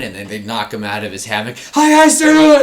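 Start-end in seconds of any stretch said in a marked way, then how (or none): 1.7-2.6 high, loud startled squeak; startled kid squeaking at his superior, high and loud; startled yelp. Pretend. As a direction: jolt awake and squeak at the officer.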